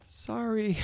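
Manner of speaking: high-pitched little mock sorry; sheepish retold apology. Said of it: "And I went".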